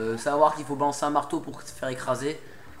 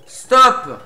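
loud, higher barked command; shouted stop; 6 semitones higher, 10 dB louder than usual. Real, not acted. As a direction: yell it at the boss. Yelling at boss.

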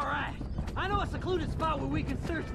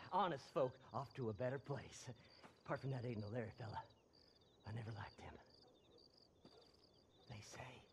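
creepy low mutter; 18 dB quieter, faster than usual. Acted. Seth muttering creepily to the corpses.

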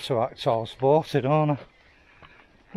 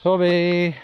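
high, louder call to a dog; 6 semitones higher, 4 dB louder than usual. Real, not acted. Calling the dog.

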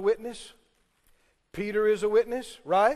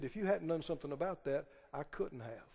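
low, much softer, faster aside; hushed quote; 8 semitones lower, 12 dB quieter than usual. Retold or imitated. Preacher quoting Jesus, hushed and low. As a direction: let Jesus' words drop soft.

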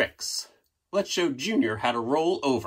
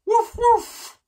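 high loud woof woof; barking; 6 dB louder than usual. Shown as a demonstration. Narrator showing the dogs at home how to bark, high loud barking. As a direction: bark like an excited dog. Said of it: "start barking".

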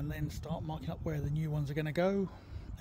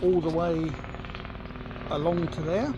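louder to beat the noise; shouting over a loud helicopter; yelling over helicopter; 10 dB louder than usual. Real, not acted. Apologies for the helicopter.

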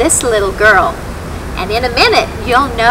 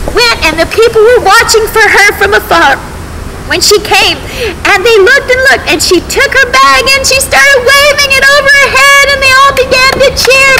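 excited; 11 semitones higher, 3 dB louder than usual. A narrator's reading. Crowd cheering homecoming.

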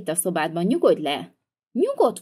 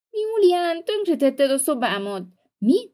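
high upset voice; 8 semitones higher, slower than usual. Acted. Sister's room wrecked.

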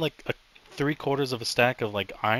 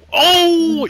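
high loud alarmed cry; alarmed shout; 16 dB louder than usual. Real, not acted. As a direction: cry out as you die. Dying in game.

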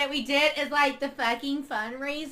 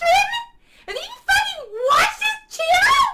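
outraged wail; 5 dB louder than usual. Acted. Ranting about fans, voice breaks.

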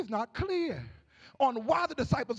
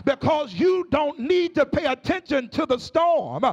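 rhythmic whooping sermon, each phrase hammered out over the organ; preaching at full force; 3 semitones higher, 4 dB louder than usual. Acted. Preacher urging the congregation to sleep and trust God, full whooping sermon. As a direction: rise into a sung preacher's cadence and drive it home.

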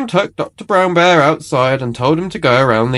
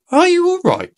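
much higher, caring nurse voice; concerned nurse. A narrator's reading. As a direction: check on the child kindly. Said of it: "she asked".

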